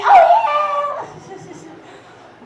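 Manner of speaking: high loud oh then breathy yeah; mock victory whoop; triumphant whoop. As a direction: cheer the high score. Celebrating high score.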